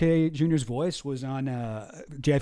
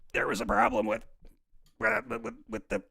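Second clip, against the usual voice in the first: a higher, breathy attempt at his voice; higher, half-failed RFK Junior; RFK Junior impression; 6 semitones higher than usual. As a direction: strain to hit the RFK voice. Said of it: "Sometimes I can do pretty good, R F K Junior".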